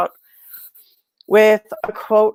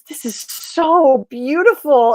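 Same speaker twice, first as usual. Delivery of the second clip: slow, higher, lightly voiced little nana; a hint of a doting grandmother, drawn out; sweet old lady; 5 semitones higher, 3 dB louder, slower than usual. Airport nana admiring the tattoo, lightly voiced and slow. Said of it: "And she goes".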